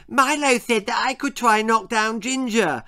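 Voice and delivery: a hint of an excited lisping little girl; eager little sister, lightly lisped. A narrator's reading. Little Lizzie telling the boys, excited and lisping. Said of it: "lisped Lizzie excitedly".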